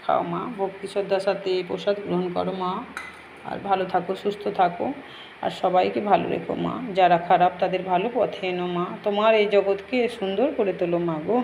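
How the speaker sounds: quiet devotional prayer; soft prayer. Real, not acted. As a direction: murmur a gentle prayer to the goddess. Offering prasad in prayer.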